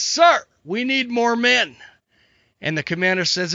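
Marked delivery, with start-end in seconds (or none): 0.0-1.8 high, drawn-out soldier's plea; urgent plea. Acted. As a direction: beg the commander for reinforcements, high and slow. Wounded soldier begging for reinforcements.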